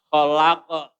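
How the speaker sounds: loud "khalaqa" with a long middle and bounced final q; correct echoing stop. Shown as a demonstration. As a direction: hold the "la" in "khalaqa", then release the q briefly. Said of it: "anunya harus begitu".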